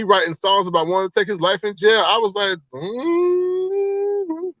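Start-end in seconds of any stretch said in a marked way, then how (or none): 2.7-4.5 high-pitched, drawn-out "mm"; long dubious hum. Retold or imitated. Dubious reaction to self-incriminating songs.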